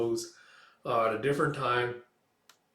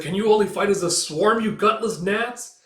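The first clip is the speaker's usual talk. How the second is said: angry taunt; 8 semitones higher, 7 dB louder than usual. Acted. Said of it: "back to angry".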